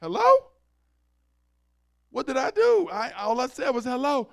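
high, loud wounded confusion; shocked hello. Retold or imitated. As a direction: act stunned someone's upset with you. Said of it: "You got family that call you and you like".

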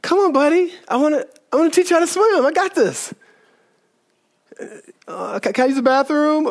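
put-on high, quick coaxing of a nervous kid; coaxing buddy.

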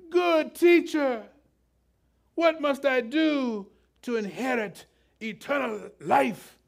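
put-on theatrical British accent, high and drawn out. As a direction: declaim it slowly like a grand British gentleman. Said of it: "why the British came out of me".

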